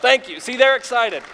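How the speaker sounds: usual speaking voice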